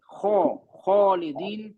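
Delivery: slow खौ, खालिदीन with the heavy throat sound. Shown as a demonstration. Teacher repeating the heavy letter for the class, slowly. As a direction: draw out खौ then खालिदीन. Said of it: "ये heavy letter है".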